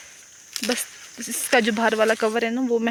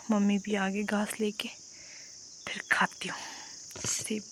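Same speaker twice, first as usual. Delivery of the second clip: softer, half-whispered words while eating; 6 dB quieter than usual. Real, not acted. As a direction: keep it hushed as if nobody should hear. Eating apple, speaking softly. (धीमी फुसफुसाहट)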